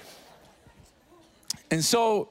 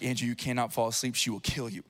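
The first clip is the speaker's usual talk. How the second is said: much lower, much softer self-warning; inner warning; 11 semitones lower, 7 dB quieter, faster than usual. Retold or imitated. Husband to himself fighting sleep, low and soft. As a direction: hush yourself awake in fear. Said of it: "and I'm like".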